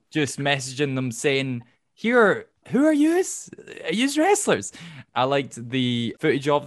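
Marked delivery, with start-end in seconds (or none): 1.9-4.7 high, excited fan voice, a mock Irish-Scottish "yous"; playfully voicing an eager fan; mock fan message. Fan messaging wrestlers, high and excited.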